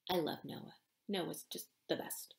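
soft fond aside. Real, not acted. Author's soft aside about character.

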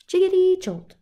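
jiggity jumps up high and loud; high bouncy jolt. Acted. Refrain, second take high.

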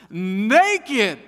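loud high gleeful taunt; grinning tease. Retold or imitated. Kid teasing the family, gleeful and loud. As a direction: savor the word with a grin. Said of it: "big grin on her face".

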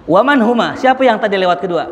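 high, loud asking voice; urgent question. Acted. Dreamer asking the figure who passed, loud and urgent. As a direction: call out who they were.

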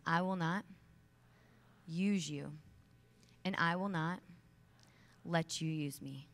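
each phrase set apart by pauses, ending slow; firm declaration. Shown as a demonstration. Speaker modelling the vow for the audience, firm and paused. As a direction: plant each phrase firmly for them to repeat. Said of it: "One more time".

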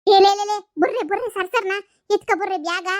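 high, loud call to run; urgent shout. Acted. Woman shouting to her friends to grab the bags and run. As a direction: yell to the others to grab the bags.

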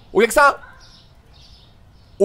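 ordinary talk

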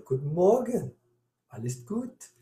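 lilting German greeting, lightly acted, rising high at the end; greeting professor. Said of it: "he would say".

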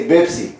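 "Pepsi" mispronounced as "Bebsi"; B instead of P. Shown as a demonstration. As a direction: imitate learners saying "Pepsi" with a b. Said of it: "they say".

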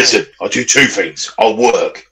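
low-pitched hammed-up working bloke; put-on bloke voice. Teasing co-host as a working man, put-on bloke voice. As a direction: play the no-nonsense working man. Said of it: "blokes that sound like that".

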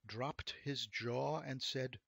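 ordinary delivery, nothing marked

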